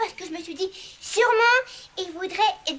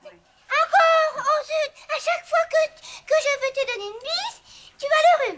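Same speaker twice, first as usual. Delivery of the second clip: exasperated; 8 semitones higher, 3 dB louder than usual. Acted. Girl frustrated at the cold.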